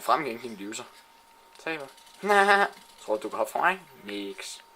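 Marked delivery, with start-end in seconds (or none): 1.9-2.9 loud, high burst of gloating laughter. Real, not acted. Gamer laughing at the player he just killed, loud gloating laugh. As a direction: laugh in the loser's face.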